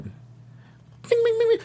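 very high-pitched pinging bings; cartoon gunshot noises. Host mocking Joe Arpaio as a cartoon shooter, high pinging noises. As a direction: squeal out little ricochet sounds. Mimics cartoon gunslinger before naming him.

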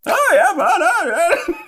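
loud, very high mocking impression; hammed-up high voice, breaking into laughter; Thornton. Host copies Brody doing an impression, hammed-up high voice. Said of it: "That was Brody doing Thornton".

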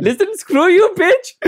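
high, loud Pakistani-accented outburst; Pakistani inflection. Shown as a demonstration. Speaker showing host her "screw you" with Pakistani inflection, high and loud. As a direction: say it with a playful, hammed-up Pakistani inflection. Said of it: "with a Pakistani inflection".

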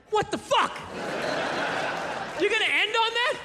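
high-pitched incredulous protest; indignant disbelief. Retold or imitated. Viewer reacts to the video's ending, high and incredulous.

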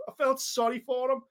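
normal talking voice

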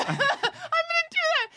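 high, slow giddy laugh-talk; gleeful about the plan; excited laughing. Real, not acted.

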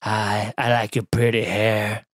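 creepy voice. Retold or imitated. Passenger creeping out the woman with long hair, low creepy voice. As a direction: purr it low and unsettling, like a creep on a plane. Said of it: "you just smile real creepily".

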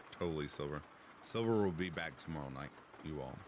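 normal talking voice

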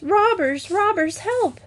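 high-pitched cries of alarm; storybook princess raising the alarm; princess screaming. A narrator's reading. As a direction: call out high, in quick bursts, as the princess. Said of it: "screamed the princess at the top of her voice".

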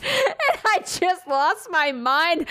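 high, slow, squealing with laughter; stretched out through helpless laughs; cracking up. Real, not acted. Recalling brother's reply, dissolving into laughter.

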